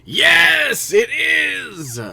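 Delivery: high, loud triumphant yell; excited shout. Real, not acted. Thrilled to get an item.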